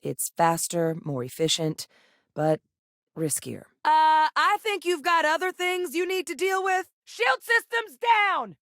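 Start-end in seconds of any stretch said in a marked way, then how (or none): 3.8-8.6 high, urgent holler; very high-pitched shouted alert; combat shout. Acted. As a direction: yell over the noise of battle. Calling out in combat.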